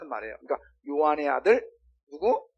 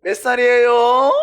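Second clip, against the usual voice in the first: much higher, louder, slow sing-song; polite question to child; 8 semitones higher, 12 dB louder, slower than usual. Shown as a demonstration. Adult asking a child's age politely, high sing-song. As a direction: ask the child ever so politely. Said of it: "어떻게 하면 돼요".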